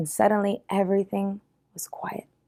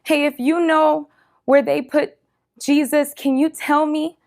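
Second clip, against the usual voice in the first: much higher, pleading voice; imitated tearful plea; 8 semitones higher, 5 dB louder than usual. A narrator's reading. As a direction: plead lightly through imagined tears, pitched up. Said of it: "because she's just crying".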